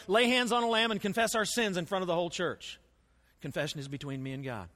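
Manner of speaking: ordinary talk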